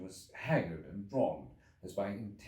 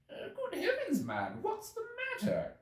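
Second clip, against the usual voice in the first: much higher, startled cry of alarm; shocked cry. A narrator's reading. Medical man crying out at the ragged time traveller, shocked. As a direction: gasp it out in shock at his state. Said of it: "cried the medical man".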